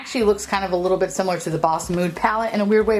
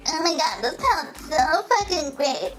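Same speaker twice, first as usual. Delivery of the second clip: gushing influencer; 8 semitones higher than usual. Retold or imitated. Mocking beauty influencers hyping the palette, higher gush. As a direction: parody the hype, over-sweet. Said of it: "all the beauty influencers like".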